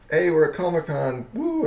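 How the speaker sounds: mock-excited convention shout-out; excited announcement. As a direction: announce it brightly, then whoop high. Acting out his excited convention post.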